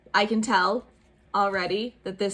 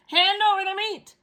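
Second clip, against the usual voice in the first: pirate demand; 10 semitones higher, 5 dB louder than usual. Acted. Braidbeard demands food.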